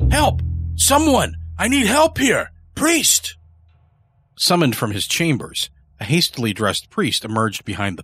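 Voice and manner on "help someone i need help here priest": very high, strained cry for help; shouting for help. A narrator's reading. Hunted man calling for a priest, desperate shout. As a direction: call out desperately, pitched way up. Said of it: "shouting at the top of his lungs".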